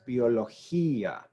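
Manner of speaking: "biología" as byo-lo-HEE-a, stress on HEE; separated vowels. Shown as a demonstration. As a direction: split the final I and A of "biología". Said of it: "So this would be pronounced".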